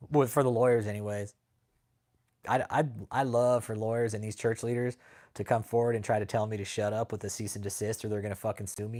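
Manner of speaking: normal talking voice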